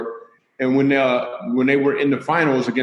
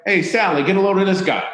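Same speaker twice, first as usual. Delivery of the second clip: mocking lady; 5 semitones higher than usual. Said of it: "this lady was like".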